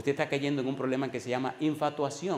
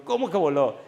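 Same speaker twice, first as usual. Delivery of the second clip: retold surprise; 5 semitones higher, 7 dB louder than usual. Pastor retells his surprised question.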